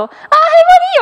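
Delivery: chatty auntie greeting. Retold or imitated. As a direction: greet grandma like a friendly ajumma, laughing. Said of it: "막 이러면서 아줌마처럼 얘기를".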